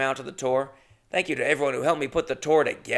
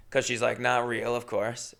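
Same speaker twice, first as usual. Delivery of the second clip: ordinary talk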